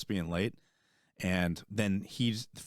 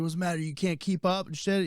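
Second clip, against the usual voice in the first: higher, taunting drill sergeant imitation; mocking sergeant; 8 semitones higher than usual. Sergeant taunting the lagging soldier, higher mock sneer.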